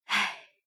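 soft, weary sigh; long sigh. A narrator's reading. Mistress falls silent over her child, then a long sigh. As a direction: let out a long, quiet sigh. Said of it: "长叹一声".

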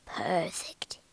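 low, soft wizard's murmur; quiet satisfaction. A narrator's reading. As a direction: murmur it, pleased with your brew. Wizard satisfied with his brew.

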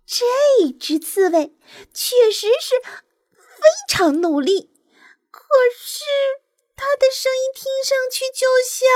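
high, exasperated nightingale voice; high-voiced bird venting to a friend; complaining. A narrator's reading. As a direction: grumble in a high pitch, pausing to find the comparison. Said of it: "他抱怨道".

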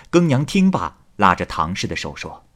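usual speaking voice

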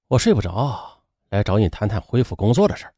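restrained, low and soft; unhurried. A narrator's reading. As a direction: hold the anger in, speak evenly. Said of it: "不紧不慢地说". (强忍怒火，不紧不慢)

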